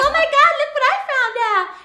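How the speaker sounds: high, fast, loud and laughing; hammed-up sister gushing about a discovery; excited discovery mimic. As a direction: gush over old news as if it's brand new. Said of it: "she's like".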